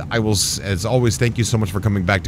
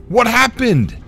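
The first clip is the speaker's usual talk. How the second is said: alarmed surprise; 12 semitones higher, slower than usual. Real, not acted. Ship crashes unexpectedly.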